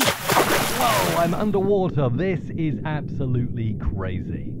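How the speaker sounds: muffled filtered voice; underwater. Shown as a demonstration. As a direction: voice heard from under water. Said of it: "Low pass filter in the parametric equalizer".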